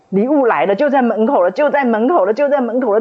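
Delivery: 越说越高越响的期待; 压不住的兴奋连声重复. Retold or imitated. 礼物就要来了的兴奋. (兴奋地重复 就在门口了)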